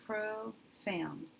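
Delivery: profound drawn out slowly, syllable by syllable; slow sounding out. Shown as a demonstration. Teacher sounding out the word for students, slowly. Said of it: "When I divide there".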